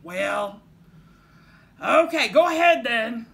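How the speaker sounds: loud, grudging troll. A narrator's reading. Troll letting the little goat pass, loudly grudging. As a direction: give in with a loud grumble. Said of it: "said the troll".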